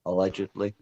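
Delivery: ordinary talk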